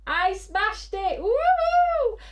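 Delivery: high, loud whoop of victory; gleeful shout; triumphant cheer. Real, not acted. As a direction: crow about the win. Celebrating her sold-out show.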